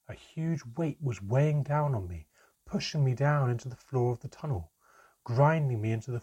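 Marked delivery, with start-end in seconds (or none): none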